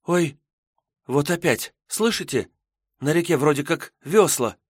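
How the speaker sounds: put-on high, alarmed young girl; startled girl voice. Maiden hears noise again.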